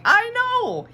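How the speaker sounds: put-on agreeing with her old classmate, thrilled; excited agreement. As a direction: squeal it back happily.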